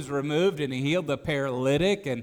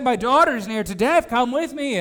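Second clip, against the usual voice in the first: urgent plea; 7 semitones higher, 6 dB louder than usual. Acted.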